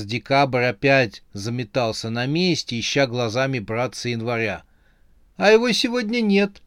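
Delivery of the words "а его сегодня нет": high, thin, timid admission; weak voice. A narrator's reading. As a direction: confess it feebly in a raised pitch. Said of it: "слабым голосом сказал он". (слабым голосом)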